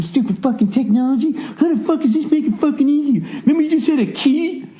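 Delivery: high, ranting complaint about technology; whiny rant. Real, not acted. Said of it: "I was just being such a fucking baby".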